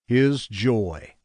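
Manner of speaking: plain reading voice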